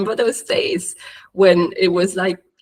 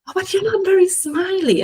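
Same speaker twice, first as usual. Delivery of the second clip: higher, mock-critical voice of her own critic; inner critic; 12 semitones higher than usual. Critiquing her own video, in a high mock-critical voice. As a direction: needle yourself in a put-on critic voice.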